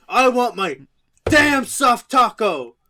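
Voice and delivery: loud, high bellow of an irate customer; hammed-up meathead rage; imitated furious yell. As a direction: slam out each word, slowing at the end. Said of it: "he's so mad".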